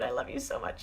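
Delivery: breathy soft whisper of love. Real, not acted. Whispering love to a viewer on her live, intimate. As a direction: whisper it close to the mic.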